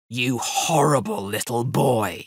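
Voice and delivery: drawn-out, scolding roar; angry roar. A narrator's reading. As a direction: roar it slowly at a naughty boy. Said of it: "he roared".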